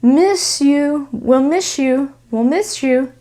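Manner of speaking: "miss you" ending almost like "shoe"; connected S plus Y. Shown as a demonstration. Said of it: "It almost sounds like shoe".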